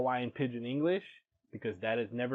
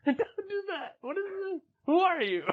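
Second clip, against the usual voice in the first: laughing squeal. Real, not acted. Laughing reaction to pidgin mockery.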